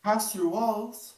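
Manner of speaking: put-on high, slow, wondering child; a small ghost girl asking in awe.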